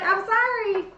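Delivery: playful retold apology; mock apology. Retold brother apologizing to his wife.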